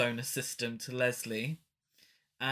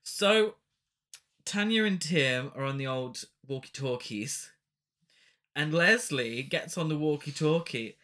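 usual speaking voice

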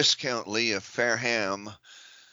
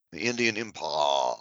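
lower, drawn-out upper-class British voice; posh British drawl, pitched lower; mock posh British drawl; 6 semitones lower than usual. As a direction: lampoon an aristocrat's drawl on the word Empire. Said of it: "That's the way they talk".

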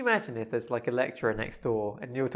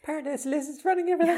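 conspiracy theorist; faster than usual. Pretend. Mock conspiracy speaker.

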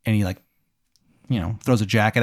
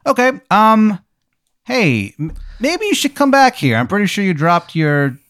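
very high, awkward, halting voice; nervous doctor; 10 semitones higher, 3 dB louder than usual. Acted. Doctor coaxing patient back in, high and nervous. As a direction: stall in a high, uneasy voice.

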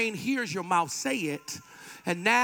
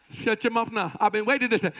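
usual speaking voice

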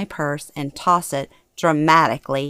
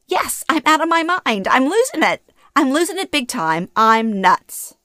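high-pitched, exasperated rant; frantic self-mockery; 8 semitones higher than usual. A narrator's reading. Daughter berating herself after leaving the theater, frantic. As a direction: answer yourself as if cracking up.

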